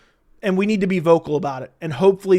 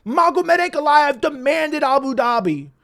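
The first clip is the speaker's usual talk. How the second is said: higher, slow sarcastic parody of the critics; mocking; 6 semitones higher than usual. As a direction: sneer it like a smug critic.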